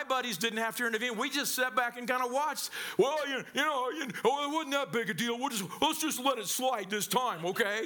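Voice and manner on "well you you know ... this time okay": backing down nervously. Retold or imitated. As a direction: stammer your way out of the fight. Rednecks back down before big brother.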